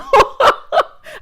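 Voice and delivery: sudden high, loud burst of laughter; real laugh at a funny moment. Real, not acted. Host laughing at Eddie telling Wendy to calm down, loud laugh.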